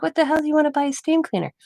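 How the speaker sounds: high exasperated question; wife scoffing at husband's steam cleaner plan; incredulous retort. Retold or imitated. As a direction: scoff at the idea in disbelief. Incredulous reply to husband retold.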